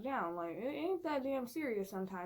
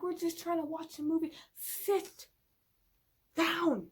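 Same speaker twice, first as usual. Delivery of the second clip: exasperated; 6 semitones higher than usual. Retold or imitated. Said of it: "I'm like".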